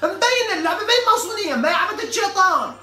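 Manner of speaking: loud, high ranting outburst; mock outrage. Real, not acted. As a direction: blow up at the listener, half joking. Mocking outburst about the game.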